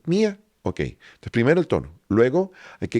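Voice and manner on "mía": mía said much higher; high pitch demo. Shown as a demonstration. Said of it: "Entonces yo digo". (mía muy aguda)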